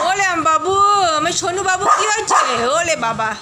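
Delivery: high, sing-song cooing at a pet. Real, not acted. Owner coos to her sulking dog Leo, sing-song baby talk.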